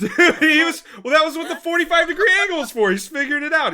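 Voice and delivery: words spilling out through real laughter; laughing. Real, not acted. Telling friends about the urinal guy, laughing loudly.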